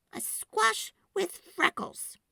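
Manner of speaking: put-on little girl voice. A narrator's reading. Girl answering rude kids, high and firm. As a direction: stand up for her friend. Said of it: "Sophie said".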